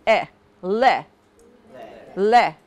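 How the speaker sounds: le, consonant straight into the vowel; le syllable demo. Shown as a demonstration. Teacher modelling le for students. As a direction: glide le fast into the vowel. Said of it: "go right into the vowel sound".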